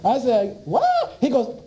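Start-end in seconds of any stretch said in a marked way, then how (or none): 0.6-1.2 shrieking disbelief, much higher; a high, incredulous yelp; stunned what. Retold or imitated. Hearing they stop people getting well.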